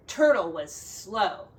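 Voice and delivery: ordinary delivery, nothing marked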